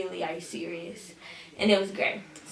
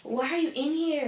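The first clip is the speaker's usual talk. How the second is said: concerned teacher; 6 semitones higher, 4 dB quieter than usual. Retold or imitated. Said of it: "She's like".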